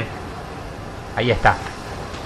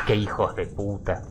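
cursing under breath; 7 semitones lower, 13 dB quieter than usual. Real, not acted. Frustrated gamer curses at trap.

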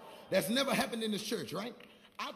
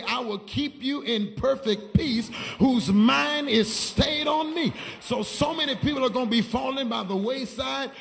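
swelling, emphatic scripture delivery; full-force preaching; 3 semitones higher, 11 dB louder than usual. Real, not acted. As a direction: proclaim it loudly to the congregation.

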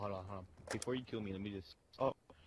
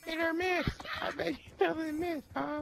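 high mock singsong, slower; squeaky meme voice; slower than usual. Friend quoting the meme.

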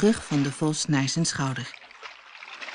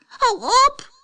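very high, louder desperate shriek; shrieking gingerbread man; 4 dB louder than usual. A narrator's reading. The gingerbread man shrieking at the fox, very high. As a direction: scream for it to stop. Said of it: "gilde de gemberkoekman".

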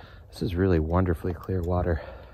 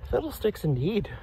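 high, prim "Fiddlesticks indeed"; mock dismay; 11 semitones higher than usual. Real, not acted. Repeats the quaint curse.

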